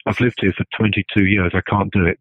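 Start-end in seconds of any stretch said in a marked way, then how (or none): none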